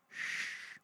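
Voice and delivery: soft unseen shush from the dark; hushing whisper. A narrator's reading. Hidden child hushing the others, in a whisper. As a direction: hush them without voice. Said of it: "came a voice".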